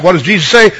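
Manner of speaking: ordinary talk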